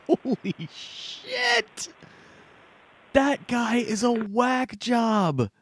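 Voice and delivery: high, slow stunned exclamation; stunned disbelief. Acted. Host reacting to a raving zealot, stunned.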